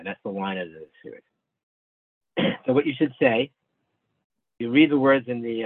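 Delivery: normal talking voice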